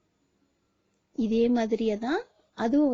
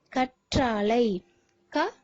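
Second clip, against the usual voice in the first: கற்றாழை said slowly and whole, every sound clear; slow clear naming; much slower than usual. Shown as a demonstration. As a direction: read கற்றாழை out slowly for children. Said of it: "இந்த மாதிரி ஒவ்வொன்னையும் நீங்க உச்சரித்து". (கற்றாழை மெதுவாக தெளிவாக)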